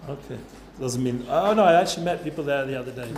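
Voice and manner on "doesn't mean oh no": ordinary talk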